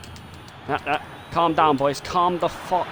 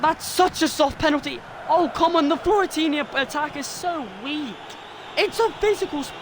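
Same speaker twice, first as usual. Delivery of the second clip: indignant rant; 10 semitones higher than usual. Real, not acted. Ranting about the penalty decision.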